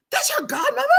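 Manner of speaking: high incredulous question; mock disbelief. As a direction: ask in mock shock.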